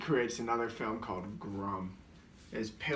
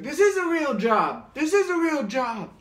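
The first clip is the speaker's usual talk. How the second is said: high, very loud screamed outburst; freak-out; 11 dB louder than usual. Retold or imitated. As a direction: scream it in a full meltdown, pitched way up. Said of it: "freak out".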